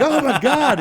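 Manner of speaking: very high, loud cry through laughter; laughing outcry. Retold or imitated. Siegel stabbed again, loud high cry through laughter. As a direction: shriek it while laughing.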